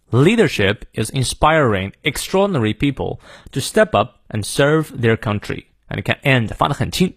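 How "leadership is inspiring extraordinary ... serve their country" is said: American accent. Shown as a demonstration. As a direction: read it in clear American English, sounding the R's. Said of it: "好，美式发音". (美式发音示范朗读)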